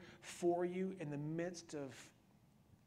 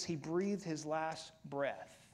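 usual speaking voice

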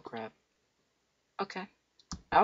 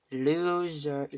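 usual speaking voice

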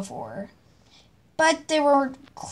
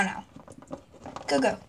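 ordinary talk

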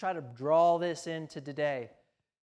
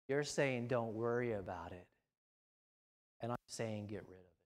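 quiet voice of God; 7 semitones lower, 6 dB quieter than usual. Retold or imitated. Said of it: "God says".

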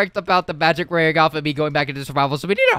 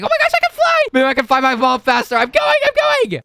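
much higher, louder thrilled squeal; excited shout; 4 dB louder than usual. Acted. Kid thrilled to fly off and find mom, squealing excitedly. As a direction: burst out with glee at flying.

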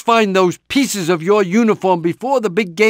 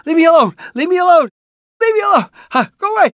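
panicked high pleading; 6 semitones higher, much faster than usual. Acted. Repeated panicked pleas.

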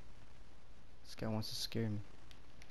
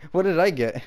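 louder and higher; louder, higher repeat; 6 semitones higher, 6 dB louder than usual. Real, not acted. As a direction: ask it louder, pushing the question. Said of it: "repeats the same question".

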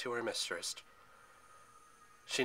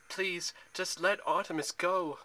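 high, slow, insistent plea; pleading demand; 7 semitones higher, 3 dB louder, slower than usual. A narrator's reading. Zoe demands her father free Artemis, pleading. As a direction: press him, pitched up and drawn out. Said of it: "Zoe demanded".

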